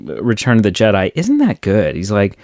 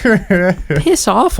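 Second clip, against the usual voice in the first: higher exasperated brush-off; mock angry Luke; 10 semitones higher than usual. Fan voicing Luke snapping at Obi-Wan, higher mock exasperation. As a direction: toss it off in fake outrage. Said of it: "And Luke's like".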